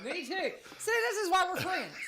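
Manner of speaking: high, loud, laughing delight. Real, not acted. Friends bonding over favourite pie, laughing. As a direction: gush it through laughter.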